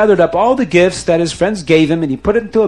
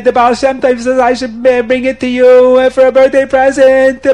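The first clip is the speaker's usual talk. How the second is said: hammed-up terrified wailing, raised pitch; crying, pleading confession; 4 semitones higher, 4 dB louder than usual. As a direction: blurt it out loudly, begging for mercy. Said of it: "he's crying and he's screaming".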